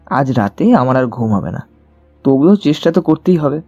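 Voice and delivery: ordinary talk, nothing marked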